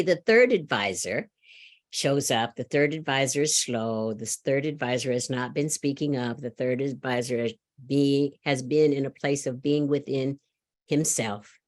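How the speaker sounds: ordinary talk, nothing marked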